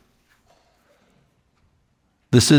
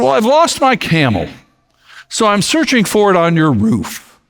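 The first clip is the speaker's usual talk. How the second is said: high voice called down from the roof; shaman calling down; 8 semitones higher than usual. Acted. Shaman calls down to the king, high and matter-of-fact. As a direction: answer as if it's obvious.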